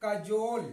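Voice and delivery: कजोल as 'kuh-JOLE', second syllable long; correct pronunciation. Shown as a demonstration. Teacher answers own question for students, correct pronunciation. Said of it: "इसका सही उच्चारण क्या होगा".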